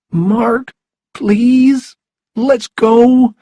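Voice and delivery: very high, loud, desperate begging; high-pitched, panicky appeal; tearful pleading. A narrator's reading. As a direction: plead like a boy about to cry. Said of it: "and began to cry".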